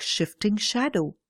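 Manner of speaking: ordinary delivery, nothing marked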